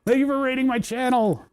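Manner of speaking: high, gushing thank-you to raiders; excited thanks. Pretend. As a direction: gush with delight, voice up high. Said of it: "address the person raiding in and go".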